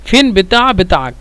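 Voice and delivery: words run together. Shown as a demonstration. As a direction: say ফেন বেতা বেতা আক as one phrase. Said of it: "একসাথে যদি বলে".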